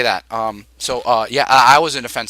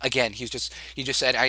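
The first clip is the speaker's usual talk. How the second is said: normal talking voice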